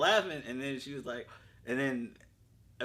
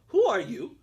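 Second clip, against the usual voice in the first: put-on high, loud child's demand; imitated little daughter; 8 semitones higher, 8 dB louder, slower than usual. Daughter answers dad's phone, high mock-child challenge. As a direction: mimic a nosy kid challenging a stranger. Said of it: "she goes".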